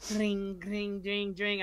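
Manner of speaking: high ringing "dring", repeated; imitated phone alerts. Co-host imitating group chat alerts at work, ringing. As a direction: mimic a phone pinging over and over. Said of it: "all of a sudden they go".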